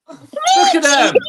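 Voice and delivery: high-pitched slow shout; high squeal. Real, not acted. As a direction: squeal it out in disbelief. Looking at pulled nose hair.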